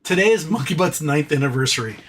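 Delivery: ordinary talk, nothing marked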